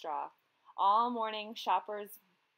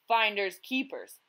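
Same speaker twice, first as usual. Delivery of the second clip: louder gruff retort; growled; 7 dB louder than usual. A narrator's reading. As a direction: growl it and turn away. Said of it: "he growled".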